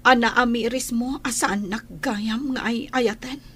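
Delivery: hushed urgent whisper; tense whispered accusation to her husband. Acted. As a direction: confront him in a whisper so nobody hears. Wife confronting husband in whisper.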